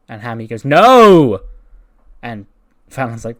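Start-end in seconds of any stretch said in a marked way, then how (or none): 0.7-1.4 loud, high drawn-out "no"; loud refusal. Acted. Hammy refusing to go again, loud and emphatic. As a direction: blurt out a big, emphatic no.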